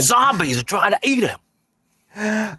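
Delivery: high, slow mock Aussie voice; Australian accent. Joking about an Australian film, slow mock Aussie accent. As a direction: stretch it out in cartoon Australian.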